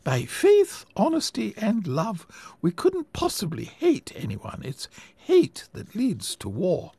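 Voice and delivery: put-on high, earnest old lady voice, fervent; a gentle old woman preaching her cause; sweet old lady.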